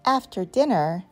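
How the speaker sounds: ordinary delivery, nothing marked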